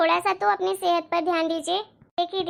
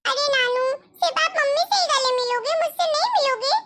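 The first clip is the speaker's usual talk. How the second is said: put-on much higher, childlike and pleading; little girl voice; 7 semitones higher than usual. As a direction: pitch it up like a small girl asking for a hug. Child wants a hug too.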